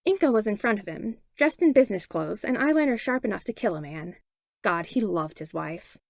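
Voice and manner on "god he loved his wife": usual speaking voice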